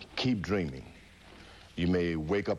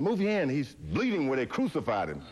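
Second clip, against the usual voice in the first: frantic cry; 7 semitones higher than usual. Retold or imitated. Fighter shouting at the doctor about bleeding, frantic. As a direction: cry out in religious delirium.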